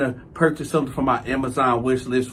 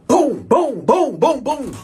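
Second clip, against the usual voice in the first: high, punchy run of booms; rapid spoken sound effects; imitated banging; 9 semitones higher, 3 dB louder, slower than usual. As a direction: voice sudden thuds in the night. Imitating noises heard at night.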